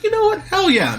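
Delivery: high-pitched, approving cheer; playful, high burst of enthusiasm; excited reaction. Retold or imitated. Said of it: "I'm always excited to see Jimmy Simpson".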